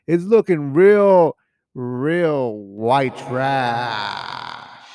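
mocking drawl. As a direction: stretch it out mockingly. Mocking drawn out judgment.

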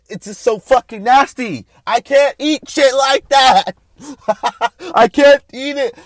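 high, loud protest of revulsion; loud disgust. Real, not acted. Host refusing to eat whole animals, loudly grossed out. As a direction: cry out that it turns your stomach.

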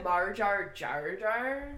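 slow, loud mock Australian "Marjar Jar Jar"; mock Australian accent. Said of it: "is that your Australian accent?".

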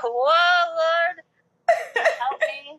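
half-laughing, half-crying cry for help; crying plea. Retold or imitated. Fan overwhelmed while hugged by her idol, teary wail. Sobbing into idol's shirt.

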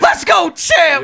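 high, loud rallying catchphrase; hyped shout. Pretend. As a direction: shout it like a hype man. Hyped-up catchphrase call between hosts.

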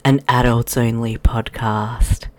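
lightly voiced sultry host intro; a touch of seductive radio host; sexy intro voice. Shown as a demonstration. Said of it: "I just talk like that for the intro".

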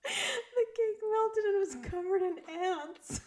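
high, loud, slow squeal of laughter; wheezing, cracking up mid-sentence; helpless laughter. Real, not acted. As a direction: barely get it out through real laughing. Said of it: "I find that so funny".

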